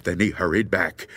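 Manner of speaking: ordinary delivery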